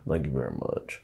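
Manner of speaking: put-on low, quiet strong-accented stage persona; deep, murmured persona voice; Big Al. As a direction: drop it low and soft, in character. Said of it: "Big Al has a very strong accent".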